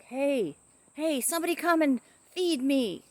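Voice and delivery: high, insistent calling voice; imitating hungry bird. Owner voicing her bird wanting dinner, high demanding call. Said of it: "if she hasn't had her dinner".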